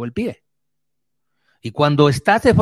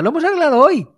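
put-on child blurting; 13 semitones higher, faster than usual. The children blurt to the visitor in a high child's voice. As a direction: pipe up like a kid spilling the secret.

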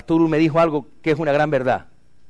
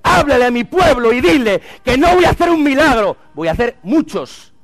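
loud, high commanding proclamation; God's voice, proclaimed; 9 semitones higher, 7 dB louder than usual. Retold or imitated. God commanding the preacher to speak to his people, loud and high. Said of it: "él me dijo".

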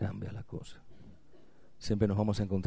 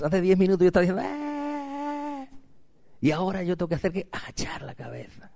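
loud, high, self-mocking fan lament; hammed-up regret of a beaten fan; 8 semitones higher, 5 dB louder than usual. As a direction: wail it like a fan who just lost. Preacher acts defeated fan lament.

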